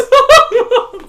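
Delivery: loud shrill put-on giggle; loud parody laugh; mock high laugh. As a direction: cackle like a smug prick. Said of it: "like a prick".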